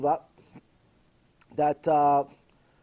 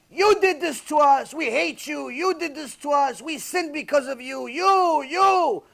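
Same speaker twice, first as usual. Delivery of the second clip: high-pitched accusing shouts from a crowd; imitated angry yelling; 10 semitones higher, 7 dB louder, slower than usual. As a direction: act out a mob yelling blame, loud and high. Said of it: "yelling at her".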